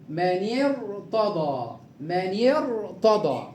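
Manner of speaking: من ارتضى slowly, ra thick; heavy ra. Shown as a demonstration. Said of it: "الراء ساكنة بعد كسر عارض".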